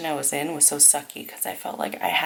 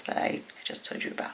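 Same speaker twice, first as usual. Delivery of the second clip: whisper; 8 dB quieter, much faster than usual. Real, not acted. Trailing off to a whisper.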